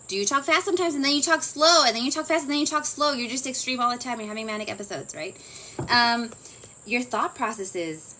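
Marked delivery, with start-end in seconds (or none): 0.1-3.1 erratic pace. Shown as a demonstration. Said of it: "They're gonna record your speech".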